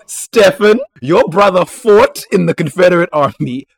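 loud mock scolding; loud laughing retort. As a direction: call him out loudly. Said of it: "which I was like".

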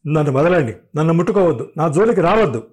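stern angry warning; harsh refusal. A narrator's reading. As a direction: keep him away, fierce. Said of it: "అంది కఠినంగా".

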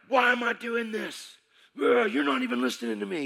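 loud, high and raw; furious yelling at the pastor; angry shouting. Retold or imitated. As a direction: yell it at him in frustration.

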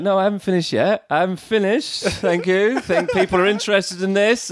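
high-pitched mimicked tipsy insistence; drunk speech-maker. Drunk best friend refusing to leave the stage, high and insistent. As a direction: imitate a drunk refusing to stop. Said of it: "she was completely pissed".

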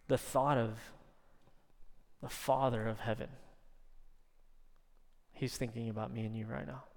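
soft, hushed, sincere preaching; tender reassurance. Real, not acted. Preacher telling congregation the Father loves them, hushed and tender. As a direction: let it sink in softly, with long pauses.

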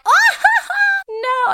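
squealed high, laughing oh no; a shocked student cracking up; mock horror. Students mocking the teacher's pronunciation, squealing high and laughing.

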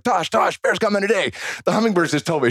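high-pitched breaking-news announcement; urgent excitement. Retold or imitated. Husband telling partner the baby is coming today, urgent excitement. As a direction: re-enact bursting in with huge news. Retelling running in with birth news.

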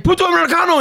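put-on Spanish-accented vendor cursing the American; angry local guy. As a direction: spit it at the gringo in Spanish-accented mock anger. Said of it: "The guy's like".

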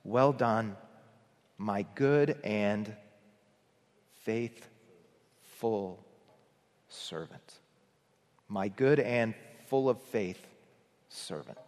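slow, softening to a hush; tender blessing. Retold or imitated. Preacher voicing Jesus praising believers, tender and hushed. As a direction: let it fade like a gentle benediction. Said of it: "I can hear Jesus saying back to us".

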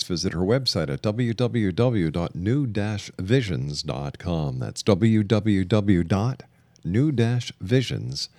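usual speaking voice

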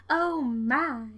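lightly put-on southern accent; a hint of a Southern belle's oh my; mild Southern drawl. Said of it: "why was that in a southern accent?".

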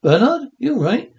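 high worried call; alarmed. Acted. Alarmed at Fred's new manner.

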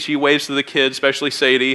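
ordinary talk

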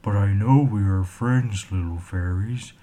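a lightly voiced cheerful troll; happy troll voice. A narrator's reading. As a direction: speak up as a shy glad troll. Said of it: "in a happy, low-toned voice".

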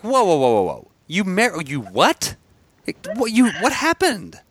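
high, incredulous whoa; rising alarm at the news; shocked disbelief. Retold or imitated. Acting their shocked reaction.

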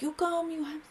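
high put-on Italian accent; mock Italian accent. Villa owner demanding sex at her door, mock Italian accent. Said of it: "I hate to do that accent".

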